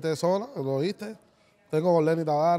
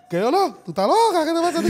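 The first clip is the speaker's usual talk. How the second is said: loud, high-pitched mock outrage; shrieky acted-out protest, pitched way up; retold outraged retort; 12 semitones higher, 7 dB louder than usual. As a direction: imitate someone indignantly shouting back.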